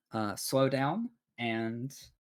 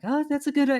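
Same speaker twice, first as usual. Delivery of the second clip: high, louder gnome interjection; gnome piping up; 14 semitones higher, 6 dB louder, faster than usual. Acted. Gnome chiming in on a passenger's plan, piping up high. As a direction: pipe up eagerly, pitched well above usual. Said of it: "hear the gnome begin to pipe up".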